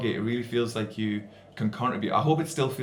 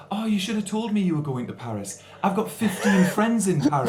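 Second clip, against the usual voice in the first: high, boastful show-off voice with a laugh; show-off phone guy; 9 semitones higher than usual. Retold or imitated. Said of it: "And he goes".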